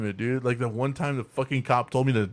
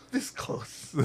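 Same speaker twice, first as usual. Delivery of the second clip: hushed, voiceless mock regret; very soft, breath-only repeat; whispered; 10 dB quieter than usual. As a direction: whisper it as a near miss.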